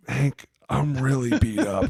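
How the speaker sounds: hammed-up player complaint; mock whiny plea. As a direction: plead like a beat-up player. Player whining to coach.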